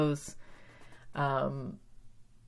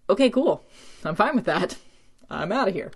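bright, breezy, loud "okay cool"; quick cheerful brush-off; perky Cordelia; 3 semitones higher, 5 dB louder than usual. Retold or imitated. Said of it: "Cordelia's like".

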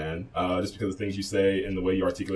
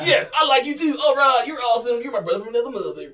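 high, loud put-on Southern twang; fake Pate accent; 6 dB louder than usual. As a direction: ham up a Southern buddy twang, higher and louder. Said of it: "that's my fake Pate slash cousin accent".

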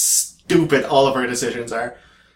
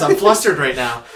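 usual speaking voice

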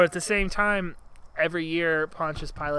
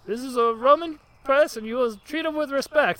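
much higher, loud pompous lisping Roman; Roman lisp accent; 9 semitones higher, 6 dB louder than usual. Retold or imitated. Roman official scolding the laughing crowd, high pompous lisp. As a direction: puff up and lisp the R's. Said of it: "another Roman with a similar accent".

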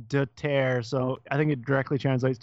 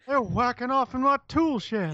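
high, put-on cartoon neighbor voice; Hank Hill prototype voice; 10 semitones higher than usual. Quoting a cartoon neighbor about his tool shed, indignant impression. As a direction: impersonate an outraged animated neighbor. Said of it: "that voice in Beavis and Butthead".

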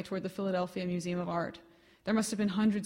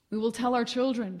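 crying out; 3 semitones higher, 5 dB louder than usual. Acted. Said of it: "she cried".

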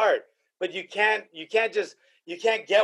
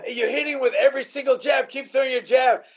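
higher, insistent coaching call; imitated corner shout; 6 semitones higher than usual. Fighter's corner calling to him mid-fight, imitated coaching shout.

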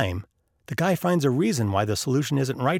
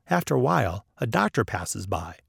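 ordinary delivery, nothing marked

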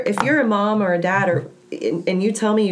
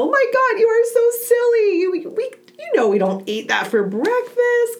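playful silly mom. Retold or imitated. Mother defusing her son's popsicle grab, playfully high and teasing. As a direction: gush at the kid like he's being adorable. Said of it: "I'll go".

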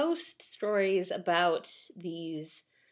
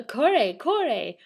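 loud, raised rough call; rough exclamation; 4 semitones higher, 7 dB louder than usual. A narrator's reading. Soba man to screaming stranger, roughly exclaiming. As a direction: challenge him brusquely. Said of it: "roughly exclaimed the soba man".

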